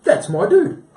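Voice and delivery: high, loud retold exclamation; excited recognition. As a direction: exclaim it like you've spotted an old friend. Retells recognising his spirit guide.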